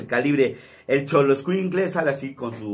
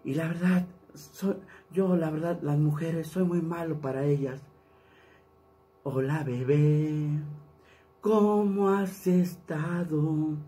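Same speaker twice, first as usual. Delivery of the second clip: softer, slow crooning lament; 3 semitones higher, 4 dB quieter, slower than usual. Shown as a demonstration. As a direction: sigh like a heartbroken singer. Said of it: "el [name] habla así".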